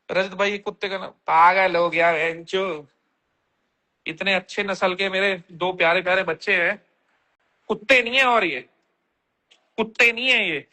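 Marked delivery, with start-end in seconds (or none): none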